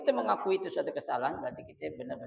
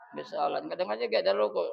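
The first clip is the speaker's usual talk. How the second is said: normal talking voice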